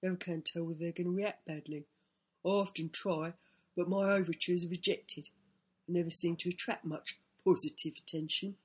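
put-on character voice for private thoughts; self-pitying inner monologue in character; rueful small animal. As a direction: voice a misunderstood little creature's lament.

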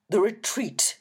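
put-on booming old reverend. A narrator's reading. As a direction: boom it back like a big man. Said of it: "he boomed".

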